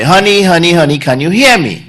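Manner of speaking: loud, high call from ten feet. Retold or imitated. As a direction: call her name across the room. Husband calling wife again.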